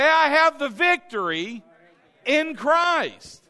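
loud, high, emphatic confession; bold declaration. Shown as a demonstration. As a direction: proclaim it with full conviction.

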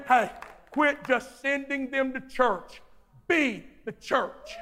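usual speaking voice

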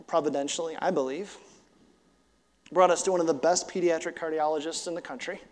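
ordinary delivery

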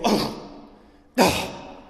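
startled huff, then snorting; sharp exhaled snorts of indignation; indignant snort. Shown as a demonstration. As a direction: huff and snort as if suddenly struck. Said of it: "Of snorting".

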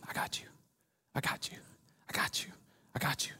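near-whisper, very soft; hushed reassurance. Acted. God speaking over each listener, hushed reassurance. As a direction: breathe it gently as a comfort.

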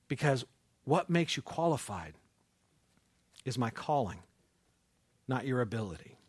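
soft, low and gentle; quiet sincere comfort; tender reassurance. Acted. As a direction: reassure a hurting listener.

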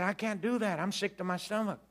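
high-pitched mock panic; panicky protest. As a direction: plead to get out of it. Acted protest of sick teenager.

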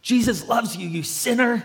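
high-pitched hammed-up condemning yell; mock-angry preachy accusation, pitched high. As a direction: imitate a crazy mean believer scolding someone. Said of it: "crazy mean Christians ... tell the truth like this".

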